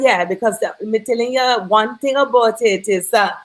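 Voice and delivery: usual speaking voice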